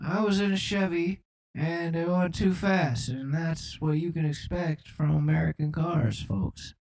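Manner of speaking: folksy doddering president; comic presidential address; Joe Biden. Acted. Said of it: "I'm just Joe Biden on the track".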